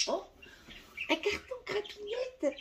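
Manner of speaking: pet talk voice. Real, not acted. Talking to her cat.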